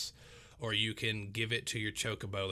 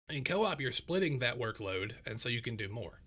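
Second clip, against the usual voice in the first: normal talking voice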